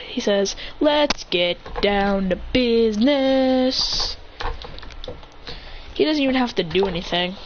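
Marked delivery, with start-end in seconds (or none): 0.8-4.2 very slow, stretched announcement; drawn-out declaration. Acted. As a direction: stretch out every word for effect. Character announces he starts work.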